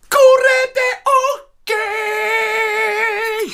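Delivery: very high, slow, stretched cheer. Retold or imitated. The rock star cheering his voice back, hammed up. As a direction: drag it out triumphantly.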